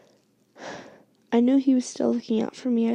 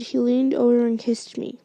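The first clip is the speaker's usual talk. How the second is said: plain reading voice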